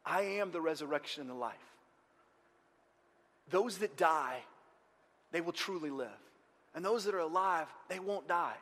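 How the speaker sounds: soft, measured reassurance with pauses; comforting. Acted. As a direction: gently get a grieving listener to listen. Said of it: "he sort of comforts her".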